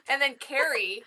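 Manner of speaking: usual speaking voice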